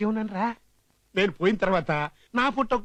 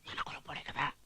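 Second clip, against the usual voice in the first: very soft, low whisper; confidential whisper; 7 semitones lower, 16 dB quieter than usual. Acted. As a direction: whisper it as a discreet aside.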